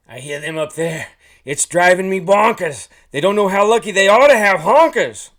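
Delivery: loud, high goose rant; exasperated goose. A narrator's reading. Honkless goose hearing his flock overhead, yelling in frustration. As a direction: build to a full-voiced frustrated yell.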